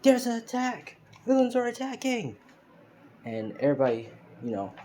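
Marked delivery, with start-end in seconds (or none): 0.0-2.4 yelled warning. A narrator's reading. Deku warns everyone of villains attacking, yelling out. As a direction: yell the alarm at the top of your voice. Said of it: "he yells out".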